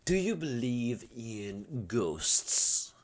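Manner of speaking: slow, breathy mock-ghostly whisper; spooky whisper. Mocking neighbor asking about ghosts, slow spooky whisper. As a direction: whisper it slow like a campfire story. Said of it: "he doesn't talk like this".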